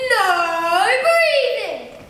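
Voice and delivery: long drawn-out booming shout; strict teacher. Acted. Strict teacher to her class, loud drawn-out command. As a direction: bellow it slowly as a strict teacher. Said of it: "used to stand at the front of the class going".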